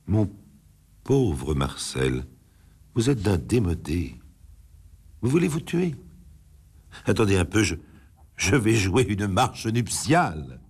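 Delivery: a light mocking woman, unimpressed; mocking disdain. A narrator's reading. Gabrielle mocking Marcel's suicide threat, lightly voiced scorn.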